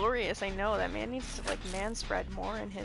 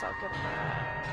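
squealed aside; 4 dB quieter than usual. Real, not acted. Joking about character's looks.

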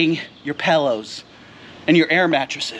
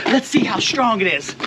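ordinary delivery

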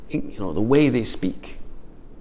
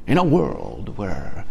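slow, deep trailer announcer imitation; a light take on the epic trailer narrator; movie trailer voice; 3 semitones lower, slower than usual. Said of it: "He does the trailers for movies".

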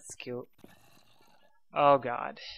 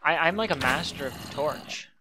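usual speaking voice

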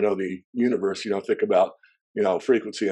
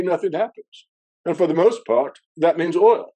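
a shaky stab at a British official, pitched much higher; amateur British accent quoting a TV minister, much higher; self-admitted British accent; 8 semitones higher, 3 dB louder than usual. Retold or imitated. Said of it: "I can't do a British accent, so forgive me".

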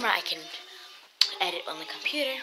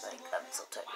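drops to a breathy whisper, much softer and lower; tired whisper; 11 semitones lower, 10 dB quieter than usual. Real, not acted. Vlogger tells viewers she is worn out, in a drained whisper.

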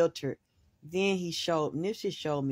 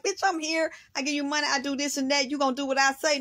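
bossy attitude; 10 semitones higher, faster than usual. Retold or imitated. Mama ordering her man around, high and bossy. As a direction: pitch it up and lay down the law. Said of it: "It's like".

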